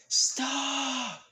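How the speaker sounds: high, drawn-out yell; Zelda yelling out. Acted. Zelda stopping Licht's sword swing, desperate yell. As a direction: cry out to halt an attack. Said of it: "Zelda just yells out".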